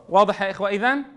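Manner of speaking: usual speaking voice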